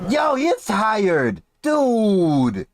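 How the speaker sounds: high, drawn-out disbelief; real incredulous outburst. Real, not acted. Reacting in disbelief to winded panelist.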